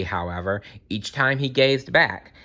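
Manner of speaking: ordinary delivery, nothing marked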